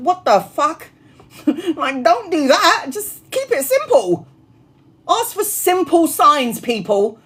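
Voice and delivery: high exasperated plea. Real, not acted. Medium pleads with her viewers to keep it simple. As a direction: throw up your hands in disbelief.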